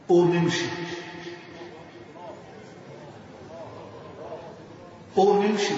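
low, firm calling command; a voice in a dream commanding him to rise and walk. Retold or imitated. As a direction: call him to rise like an unseen voice. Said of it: "بصوت مرتفع".

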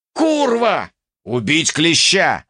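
high opening curse, then dropping; angry cry. A narrator's reading. Enemy cursing in battle. (злобный выкрик)

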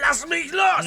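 high, loud shout; yell. Acted. Shouting to be let go, angry.

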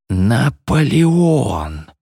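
solemn seance announcement, long vowels; awed revelation. A narrator's reading. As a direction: draw the name right out in wonder. Name revealed at the seance.

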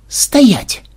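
high, sudden order; sharp command. A narrator's reading. Programmer freezing the excited granddaughter, commanding shout. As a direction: halt someone with a shout. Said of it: "скомандовал Ксенобайт".

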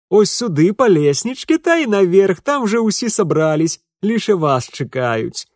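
put-on high, loud peasant girl chatter; bustling country maid, calling ahead; servant girl voice. A narrator's reading. Adarka leading him upstairs. (голос деревенской служанки)

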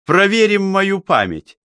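high, eager declaration; keenly interested. A narrator's reading. Chemist is keen to test his memory, keenly interested. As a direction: say it keenly, pitched well up. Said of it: "Цветов был очень заинтересован".

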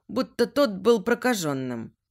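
plain reading voice

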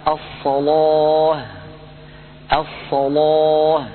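slow chanted الصلاة with the لام full and heavy; الصلاة drawn out with a heavy لام, Warsh style; Quranic recitation. Shown as a demonstration. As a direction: recite الصلاة slowly, filling the mouth on the لام. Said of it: "مثال ذلك قوله تعالى".

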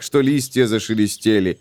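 plain reading voice

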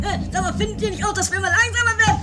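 high, fast, mocking voice; mock squeaky question. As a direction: parrot the question high and quick.

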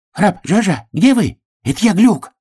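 high, muffled voice from inside a barrel; muffled hare. A narrator's reading. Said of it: "глухой голос зайца".

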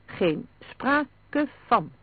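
very slow, emphatic refusal; decided tone. A narrator's reading. Vlaanderen forbidding his wife to come along, decided. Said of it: "zei Vlaanderen op besliste toon".

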